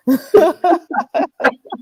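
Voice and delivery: high amused cackle; high laugh. Real, not acted. Host still laughing at the comment, high laugh.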